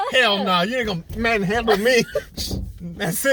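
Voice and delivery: loud pretend refusal; mock indignant. Woman refusing the man, loud mock indignation. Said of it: "she was like".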